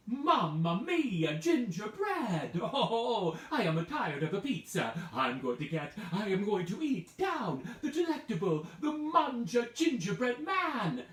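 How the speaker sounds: loud, hammed-up Italian accent; Italian pizza man. Pizza parlor man in Italian accent craving the gingerbread man. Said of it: "He said".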